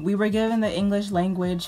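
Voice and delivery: usual speaking voice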